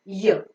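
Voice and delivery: U said as a short "yuh" sound; a brief Y glide into a neutral vowel. Shown as a demonstration. Teacher giving the alternative sound of U, short yuh. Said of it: "or sometimes it is".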